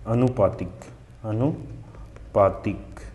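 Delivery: अनुपातिक said slowly, split as अनु, पातिक; broken-up dictation. Shown as a demonstration. Teacher reads out the quiz word, slow and broken up. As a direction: draw out अनुपातिक in two separate parts. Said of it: "ठीक है, अनुपातिक होता है".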